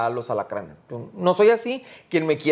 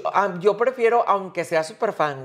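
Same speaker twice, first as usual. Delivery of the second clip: ordinary talk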